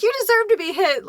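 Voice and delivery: acted goading. Friend tells off the mean one, high goading. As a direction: push the point in a high acted voice.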